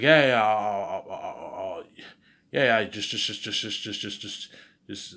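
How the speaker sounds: stuck on repeated words, trailing away; flustered stammer. Retold or imitated. Driver cornered by the cop over a fine, flustered stammering. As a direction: stumble nervously over each word, starting loud.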